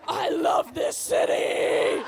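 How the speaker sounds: slow, loud, airy cry of joy; ecstatic city cry. Retold or imitated. Friend at the window mid-hookup, loud slow ecstatic cry. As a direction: belt it out like a climax at the window.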